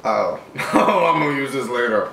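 usual speaking voice

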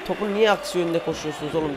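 normal talking voice

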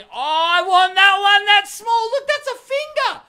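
very high, loud squealed outburst; excited yell. Pretend. Host spotting the tiny quadcopter, high loud mock yell.

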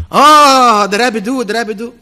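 loud, high jeering call of der Rebbe; mock shout. Taunting the man in the street, a loud high jeer. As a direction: yell it out like a taunt.

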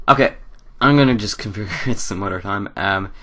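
ordinary talk

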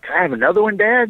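put-on asking as a kid. Kid asking dad for beer.

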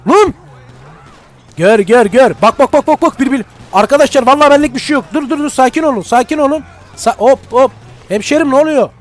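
high, loud panicky shouts; panicked shouting. Pretend. Player shouts in mock panic as the police arrive, high and loud. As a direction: yell in mock panic as it kicks off.

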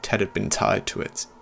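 ordinary delivery, nothing marked

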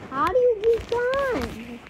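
high, slow, drawn-out call; insistent repeat. Real, not acted. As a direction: stretch it out high to demand an answer. Impatient louder repeat of question.

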